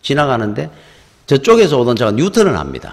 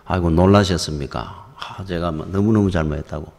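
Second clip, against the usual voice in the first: humble apology; 6 semitones lower, 5 dB quieter than usual. Retold or imitated. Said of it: "고개를 숙이고 사과를 하더라".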